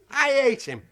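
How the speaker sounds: high, loud hate. Retold or imitated. Fed up with the dog, the second take high and loud.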